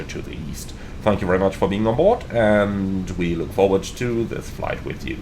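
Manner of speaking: pilot PA announcement; captain announcement. Acted. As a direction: welcome the passengers as captain. Captain welcome announcement to passengers.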